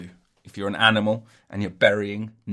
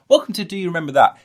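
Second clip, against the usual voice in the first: loud, fast show-host welcome, higher; fast show host intro; 8 semitones higher, 8 dB louder, much faster than usual. Pretend. Podcaster redoing his intro catchphrase, loud and fast. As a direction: rattle it off like a TV host. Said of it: "I've got, um".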